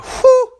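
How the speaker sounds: very high, loud whew with a laugh in it; a piercing high whew, half laughing; showing how he and his friends react to something fire; hype whew. Shown as a demonstration. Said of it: "We kinda combined it with".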